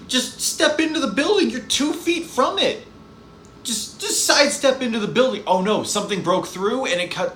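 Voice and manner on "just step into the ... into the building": exasperated yelling. Real, not acted. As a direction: yell at her to just step inside. Yelling advice at a character.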